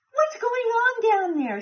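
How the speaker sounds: sleepy shout. A narrator's reading. Squirrel woken up, calling down to Dragon, high. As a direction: call down from the treetop. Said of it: "shouted a sleepy-eyed squirrel".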